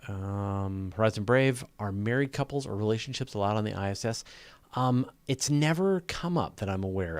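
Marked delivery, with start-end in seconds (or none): none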